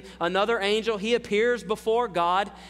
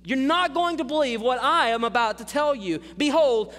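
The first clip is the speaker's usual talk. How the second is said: raised, emphatic warning; calling to attention; 6 semitones higher than usual. Retold or imitated. Jesus warning Peter he will not believe it, commanding attention. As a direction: demand his attention with a higher, forceful delivery. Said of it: "calls him to attention".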